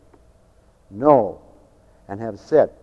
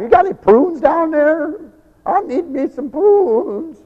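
put-on higher, slow old-timer asking; old caller; 13 semitones higher, 5 dB louder, slower than usual. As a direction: lightly voice an elderly caller pleading. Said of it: "you old people... call me up".

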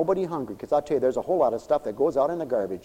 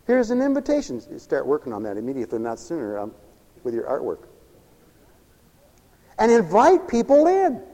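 ordinary delivery

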